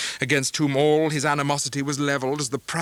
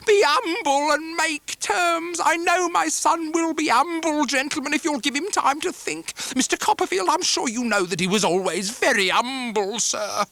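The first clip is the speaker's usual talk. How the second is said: put-on much higher whining old woman begging the gentlemen; pleading mother; 14 semitones higher, 4 dB louder than usual. Mrs Heep pleads.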